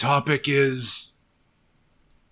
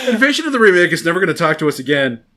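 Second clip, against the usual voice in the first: loud, fast, high lament; melodramatic wail; 4 semitones higher, 7 dB louder, much faster than usual. Acted. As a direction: wail it in mock panic.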